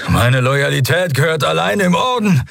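high, rising declaration of loyalty; strained captive's avowal; desperate protest. A narrator's reading. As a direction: insist with effort, pitch climbing. Drugged captive swears his loyalty.

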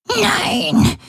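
put-on an injured old woman croaking a refusal; croaked. A narrator's reading. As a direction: croak it out in pain. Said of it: "krächzte Voi".

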